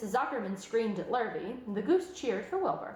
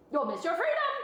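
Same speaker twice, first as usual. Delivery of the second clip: high, louder goose honk; 9 semitones higher, 4 dB louder than usual. A narrator's reading. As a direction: honk the warning. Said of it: "honked the goose".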